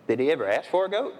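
ordinary delivery

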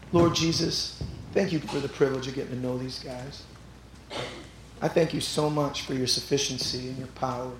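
soft closing prayer. Acted.

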